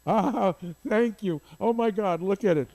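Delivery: high, excited burst of delight; delighted exclaiming. Real, not acted. Spotting the Bud Schulberg photo, delighted high exclaiming. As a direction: gush with real delight at the find.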